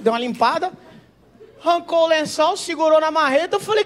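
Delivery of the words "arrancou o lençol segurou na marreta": normal talking voice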